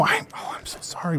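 whispered, apologetic aside; very soft, voiceless sorry; hushed apology. Acted. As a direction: let it drop to a breath of regret. Awkwardly turns down a proposal.

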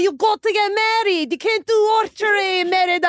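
put-on Scottish accent. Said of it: "I'm doing Brave".

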